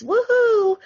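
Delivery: high-pitched mock cheer; high, sing-out sarcastic woohoo; mock celebration. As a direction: whoop like an overjoyed super parent, in jest. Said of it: "acting all super co-parents".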